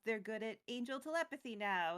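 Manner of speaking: ordinary talk, nothing marked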